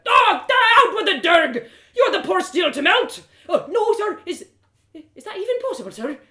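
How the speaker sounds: put-on a giddy boy blurting out in awe; excited apprentice.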